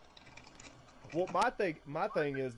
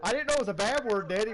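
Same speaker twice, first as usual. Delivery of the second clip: put-on higher, louder little-boy pleading; imitating whiny son; 7 semitones higher, 6 dB louder, faster than usual. Son protesting to his dad, higher little-kid whine.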